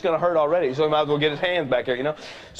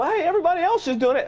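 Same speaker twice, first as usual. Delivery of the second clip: put-on much higher and louder whining kid; whiny kid; 14 semitones higher, 4 dB louder than usual. Kid whining an excuse to dad, high and loud. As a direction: whine it like a teen begging dad. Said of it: "Or, you know".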